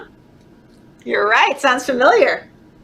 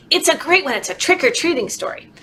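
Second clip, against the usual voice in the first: plain reading voice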